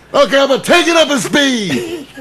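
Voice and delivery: a grinning father about to hit the gas; the dad teasing the date on skis, mock gleeful; imitating the dad. Dad voiced in retold story.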